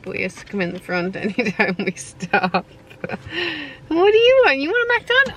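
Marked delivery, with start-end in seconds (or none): 3.1-5.3 high dog voice. Real, not acted. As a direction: coo up high like to a puppy. Talking to dog in car.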